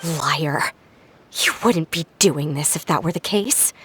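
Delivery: low bitter accusation. Acted. Betrayed member accuses family.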